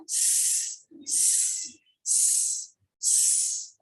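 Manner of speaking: a long unvoiced hissing "s"; hissed s sound. Shown as a demonstration. Teacher demonstrating the snake sound s. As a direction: hiss "s" like a slithering snake. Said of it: "as if the snake is slithering and say the sound".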